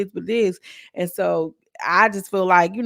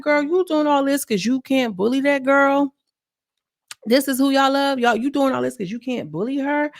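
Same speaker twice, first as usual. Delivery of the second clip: imitating a confrontational call-out, pitched up; retold scolding; 7 semitones higher than usual. Ivory going off on someone who can't bully that girl, retold scolding. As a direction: scold in a higher, insistent retelling voice.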